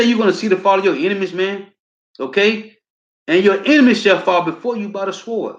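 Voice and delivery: rally the crowd with it; fired-up preaching. Acted. Excited preaching of prophecy.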